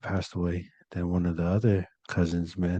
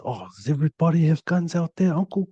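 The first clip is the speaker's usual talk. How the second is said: put-on higher, excited kid asking uncle; child voice; 10 semitones higher, 5 dB louder than usual. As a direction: voice a curious little nephew. Nieces and nephews asking, voiced.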